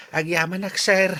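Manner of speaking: high, bright burst of thanks; grateful thanks. Acted. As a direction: thank him with delight. Janitor thanks boss for loan.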